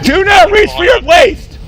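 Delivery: high, slow, emphatic warning; shouted warning. Real, not acted. Officer stopping a man reaching for his waist, shouted warning.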